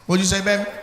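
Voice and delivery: normal talking voice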